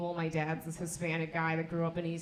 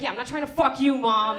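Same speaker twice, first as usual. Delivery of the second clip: louder, fed-up snap at mom; exasperated retort; 4 semitones higher, 9 dB louder than usual. Retold or imitated. Daughter snapping back at her mother, exasperated. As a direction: shoot back at mom, irritated. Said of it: "I was like".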